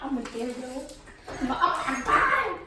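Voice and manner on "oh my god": slow, high laughing cry. Real, not acted. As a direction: laugh it out in disbelief. Laughing through spicy pain.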